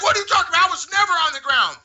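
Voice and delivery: high, loud disbelieving denial; retold outraged denial; imitated indignant staffer. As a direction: protest in a worked-up high voice. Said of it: "He was like".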